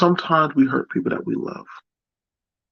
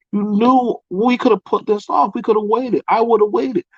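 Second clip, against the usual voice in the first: put-on shrill-free but pitched-up upset girlfriend voice; 8 semitones higher than usual. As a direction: pour out hurt and blame at him. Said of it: "She was like".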